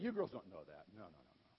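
ordinary talk, nothing marked

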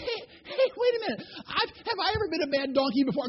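very high, protesting donkey voice; comic high-pitched animal plea; pleading talking donkey. Acted. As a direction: beg for a pause in a high cartoon voice. Beaten donkey pleads with Balaam.

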